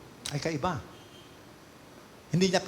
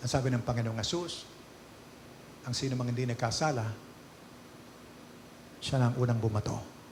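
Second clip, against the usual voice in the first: usual speaking voice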